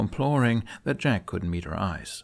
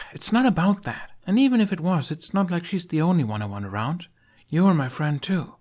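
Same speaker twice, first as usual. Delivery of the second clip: put-on higher, earnest young woman; young woman's voice; 11 semitones higher, 5 dB louder than usual. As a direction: speak up to a friend, insisting.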